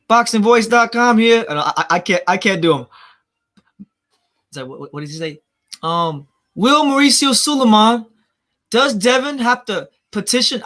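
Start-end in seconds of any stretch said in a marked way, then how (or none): 0.1-1.5 higher, louder, slow announcer sign-off; Nestor Gibbs impression. Streamer imitating a boxing host's sign-off. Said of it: "how, how does he go?". 6.6-10.6 high, slow, loud interviewer question; Nestor Gibbs impression. Streamer attempting the boxing reporter's question voice. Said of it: "I, I can't do his voice".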